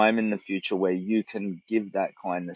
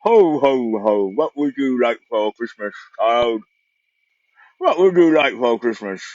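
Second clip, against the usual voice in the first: Santa voice; 3 semitones higher, 6 dB louder than usual. Retold or imitated. Vlogger playing Santa for his beard, loud jolly Santa voice. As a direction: boom out a jolly ho ho ho like a mall Santa. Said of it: "Oh, I look like Santa, don't I?".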